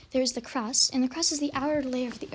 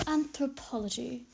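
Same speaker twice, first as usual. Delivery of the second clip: slow, drawn-out stab at a British professor; British-style "Anthropology", slowly enunciated; attempted British accent; 4 dB quieter, slower than usual. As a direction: badly attempt a British accent, stretching the word. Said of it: "I'm trying to imitate it".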